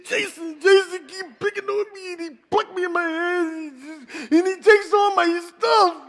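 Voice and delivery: put-on high, whiny little-boy voice, slowing at the end; crying little boy. Preacher voicing a little boy complaining to his brother, high and whiny. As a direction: play a hurt kid telling on the bully. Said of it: "and he's crying his eyes out".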